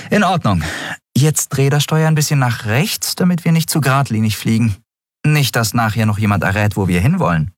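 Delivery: captain giving orders. Acted. Captain directs Miko to turn right, brisk. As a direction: steer him on, a little wry.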